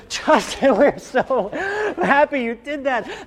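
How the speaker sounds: high laughing teacher voice; a teacher laughing with glee; delighted teacher. Retold or imitated. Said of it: "a teacher went".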